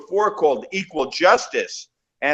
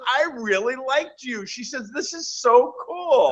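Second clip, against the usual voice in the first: high, excited mimicry of an actress; high-pitched quoted enthusiasm; imitated delighted gushing; 9 semitones higher than usual. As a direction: gush like a thrilled fan, retold. Retelling actress's delighted reply.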